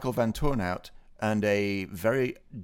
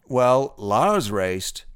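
ordinary delivery

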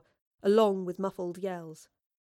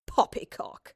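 scoffing; slower than usual. Acted. Woodliffe scoffs.

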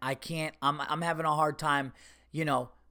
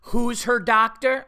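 slow, louder impatient repeat of the question; mock her testy insistence, higher and louder; upset receptionist; 7 semitones higher, 7 dB louder, slower than usual. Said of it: "already she's getting, like, upset".

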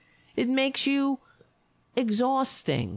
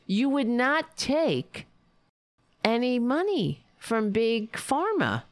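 normal talking voice

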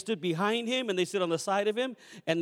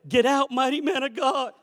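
urging driver; 7 semitones higher, 6 dB louder than usual. Retold or imitated.

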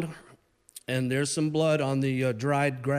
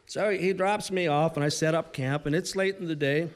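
ordinary talk

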